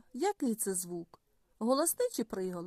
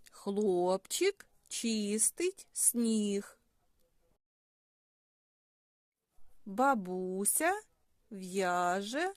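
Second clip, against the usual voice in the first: slow, with a gap after each word; slower than usual. Shown as a demonstration. Teacher composing sentences from a scheme with children, word by word. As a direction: lay out each word separately and slowly.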